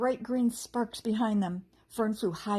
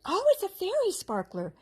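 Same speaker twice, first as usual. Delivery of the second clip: high, louder bright exclamation; excitedly; 11 semitones higher, 5 dB louder than usual. A narrator's reading. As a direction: burst out with delight at the discovery. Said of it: "said Fern excitedly".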